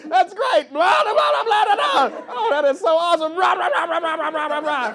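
high, shouted gibberish; overbearing babble. Acted. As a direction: steamroll them with loud mock enthusiasm. Acts comic blaring over pleading audience.

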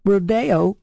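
"Rodeo" as ro-DAY-o; second-syllable stress. Shown as a demonstration. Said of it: "with the accent on the second syllable".

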